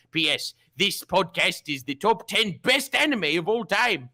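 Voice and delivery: hammy Scottish accent, slow start. Shown as a demonstration. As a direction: read it like a Scottish sea captain. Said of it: "do the whole thing again in a Scottish accent".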